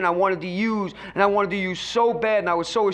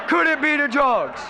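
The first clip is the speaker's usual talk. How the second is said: rising alarm; 5 semitones higher than usual. Retold or imitated. Repeating the inner question, louder.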